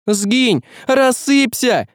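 high-pitched, urgent cry; exclaimed banishment. Acted. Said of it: "воскликнула она".

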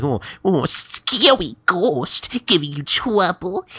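put-on high, slow taunting baby-talk; mocking. Gintoki taunting Hichikata about the scary ghost, mocking. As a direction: tease with drawn-out mock pity. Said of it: "start mocking Hichikata".

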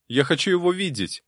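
high-pitched, stubborn insistence; stubborn demand. Acted. Novice refusing to give up before the abbot, stubborn.

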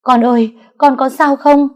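a mother screaming for her son; mother screaming over her stabbed son; wailing cry. Acted. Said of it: "gào lên".

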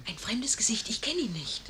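low whispered aside. Acted. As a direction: keep it private, barely voiced. Maid whispers aside to lady. (leises Flüstern beiseite)

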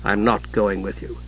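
low, soft, steady refusal; quiet. A narrator's reading. Son refusing to follow his mother, quietly. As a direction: keep it low and hushed. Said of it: "Steele said quietly".

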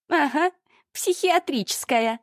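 put-on high, slow sneering young woman; ехидная Ольга. A narrator's reading. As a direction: drag it out with contempt. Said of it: "подала голос Ольга". (насмешливый высокий голос)

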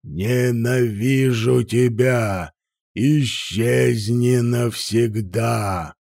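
slow, menacing dragon voice; huge fiery dragon roar. A narrator's reading. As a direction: drag out the hatred like a dragon. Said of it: "заревел дракон". (медленный злобный голос дракона)

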